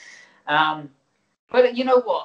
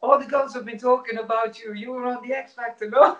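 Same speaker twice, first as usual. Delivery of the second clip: hammed-up Polish accent, higher than his own; mock Polish accent; 7 semitones higher than usual. Boss teasing him about the X Factor, in a mock Polish accent. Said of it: "I was supposed to be Polish".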